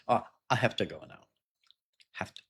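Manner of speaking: quick, run together "have to" as "hafta"; "I have to go now" said fast and casual; relaxed everyday rhythm; natural reduced. Shown as a demonstration.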